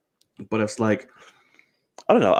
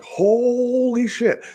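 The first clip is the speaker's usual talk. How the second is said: high, drawn-out awed holler; mock amazement; 13 semitones higher, much slower than usual. Host playing down his hopes to co-host, mock amazement. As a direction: stretch it out high, hammed-up awe. Mimes an over-the-top amazed reaction.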